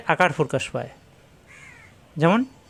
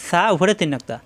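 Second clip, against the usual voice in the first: ordinary talk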